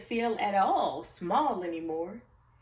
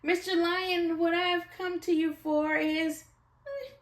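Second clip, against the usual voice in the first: small mouse voice; 9 semitones higher, 3 dB louder than usual. Acted. Mouse asks lion.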